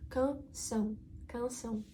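Canção with the tilde vowel sent through the nose; nasal vowel. Shown as a demonstration. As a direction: say Canção twice with a nasal ending. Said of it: "буде показувати носовий звук".

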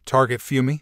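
plain reading voice

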